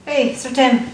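normal talking voice

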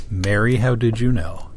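plain reading voice

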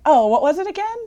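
put-on a dreamy boy who forgot the word; lightly voiced airhead; spacey kid. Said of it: "he's like".

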